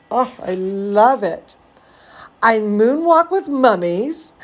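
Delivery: usual speaking voice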